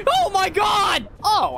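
high, drawn-out shriek; shocked scream. Real, not acted. Player watching the monster explode, shocked scream. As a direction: cry out in shock, stretched slow.

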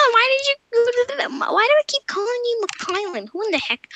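high, incredulous squeak at her own slip; baffled squeal. Real, not acted. Girl stunned she used the wrong name, high squeal. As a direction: squeal it in disbelief at yourself.

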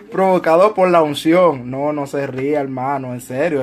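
ordinary delivery

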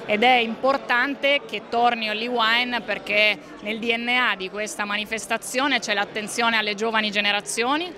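ordinary delivery

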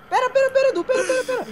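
high frantic shouting; panicked yelling. Real, not acted. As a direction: beg them to wait, yelling.

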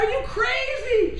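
high, loud replay of the disbelief; shocked disbelief. Retold or imitated. Husband to wife again, high stunned question.

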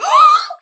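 loud, high-pitched gasp; startled gasp. Real, not acted. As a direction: gasp sharply at a sudden sighting. Suddenly spots a wolf.

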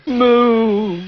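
loud high cow moo. Acted. Cow noise in story.

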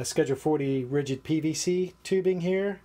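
ordinary talk, nothing marked

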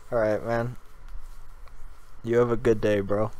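lightly voiced street dude signing off.

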